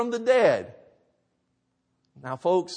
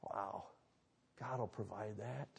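hushed awe; 13 semitones lower, 24 dB quieter than usual. Real, not acted. Awed hushed repeat of faith.

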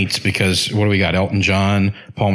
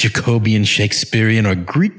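ordinary talk

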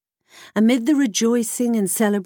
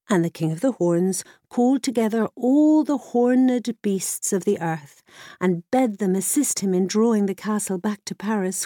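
plain reading voice

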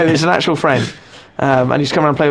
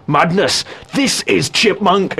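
mock Spartan king; 3 semitones higher, slower than usual. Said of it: "the main chipmunk goes".

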